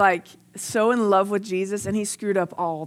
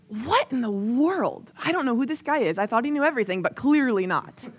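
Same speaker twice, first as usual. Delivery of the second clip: mock disbelief; 3 semitones higher than usual. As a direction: act out Peter's incredulity, slowing at both ends. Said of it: "And he's like".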